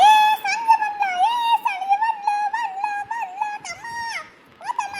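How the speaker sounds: high-pitched joyful shout, easing in volume; squealed, repeated calling; excited calling. Acted. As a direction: announce the friend's arrival to everyone. Friend arrives, calling out to brother.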